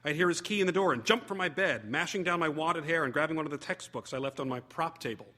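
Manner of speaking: plain reading voice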